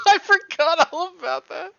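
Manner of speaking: loud, high laughing cry; laughing shout. Real, not acted. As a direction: burst out laughing as you say it.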